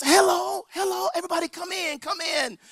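high, sing-out auntie greeting; imitated auntie's high welcome; welcoming hostess. Hostess welcoming visitors at the door.

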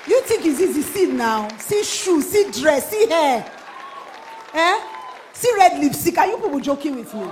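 loud, high exclamations of admiration; mock-indignant, high-energy hype; excited hyping. Real, not acted. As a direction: shout her praises to the crowd, item by item. Hyping a woman's outfit to crowd.

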